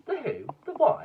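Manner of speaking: high, loud baffled yelps; surprised crowd. Acted. Bystanders confused by the name, startled high questions. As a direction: squeak it out in disbelief.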